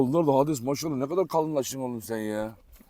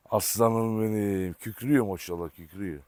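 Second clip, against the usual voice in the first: fond cooing; 7 semitones lower, 8 dB quieter than usual. Real, not acted. Cooing over his dog.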